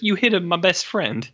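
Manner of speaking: a hint of an old Italian barman defending his friend; old Italian man. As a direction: Martini the barman shielding George, in a light old Italian voice. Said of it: "this old Italian man. He's like".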